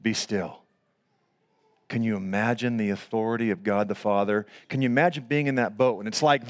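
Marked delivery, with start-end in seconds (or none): 0.0-0.6 low, soft command; quiet rebuke. Acted. Jesus to the water, quiet command. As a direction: still it with a soft, low order. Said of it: "he rebuked the wind and said to the water".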